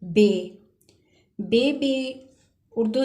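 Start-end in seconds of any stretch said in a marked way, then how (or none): 0.0-0.3 बे said with a firm b and long e; teacher modelling बे, a bit louder; German letter बे. Shown as a demonstration. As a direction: sound out बे clearly for students. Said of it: "next हर्फ है".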